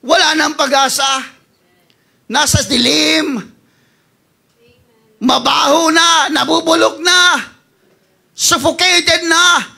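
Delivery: rising fervor, proclaim it; impassioned preaching. Acted. Pastor declaring God's word to the congregation, loud and rising.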